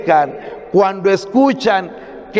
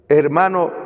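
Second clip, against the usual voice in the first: ordinary talk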